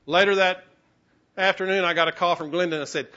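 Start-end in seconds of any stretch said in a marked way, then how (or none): none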